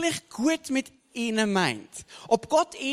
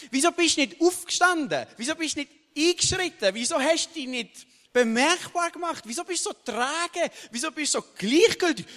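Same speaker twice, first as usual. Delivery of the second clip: higher, driving run of "wieso" questions; preaching at full force; 6 semitones higher than usual. Acted. Preacher confronting listeners through Adam, urgent sermon voice. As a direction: hammer each "wieso" at the congregation.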